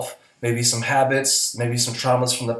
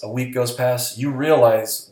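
normal talking voice

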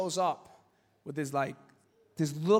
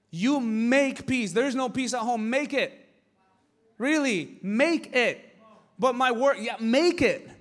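loud, higher, slow insistent preaching; fiery preaching; 5 semitones higher, 5 dB louder than usual. Acted. Preacher urging the congregation to make peace, fiery. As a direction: drive the command home to the congregation.

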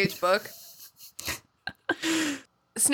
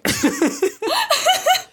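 loud high laughing burst; burst of high laughter; 4 dB louder than usual. Real, not acted. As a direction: crack up loudly. Loud laugh after story.

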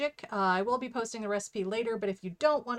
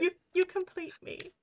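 mock romantic; 9 semitones higher than usual.

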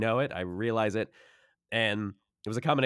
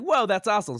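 excited; 11 semitones higher, 7 dB louder, faster than usual. Retold or imitated. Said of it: "Some people are like".

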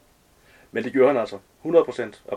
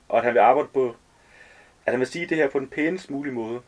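plain reading voice

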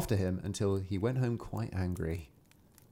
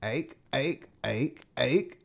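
croaking frogs; 2 semitones higher, much slower than usual. A narrator's reading. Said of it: "he heard the frogs crying".

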